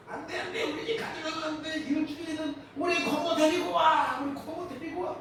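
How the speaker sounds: put-on high, soft, childlike pleading cry; a little boy begging the horned things to leave; feverish child pleading.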